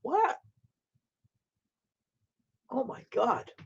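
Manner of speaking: high, soft gasp of disbelief; real shock. Real, not acted. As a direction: take it in, stunned, voice up high. Shocked by chat's news.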